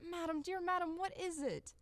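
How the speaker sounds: put-on panicked old servant; alarmed. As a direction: rush to her in fright. Servant alarmed at mistress's cry.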